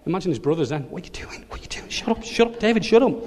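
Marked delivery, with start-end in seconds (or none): 0.8-1.9 whispered panicky question, repeated; hushed, breath-only alarm; frantic whisper. Acted. Brothers hissing at David. 1.9-3.1 high, strained whisper rising into voice; panicked hushing. Acted. Brothers begging David to be quiet.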